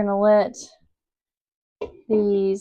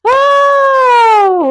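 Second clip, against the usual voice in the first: exasperated cry; 12 dB louder than usual. Real, not acted. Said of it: "overwhelmed mom exclaims".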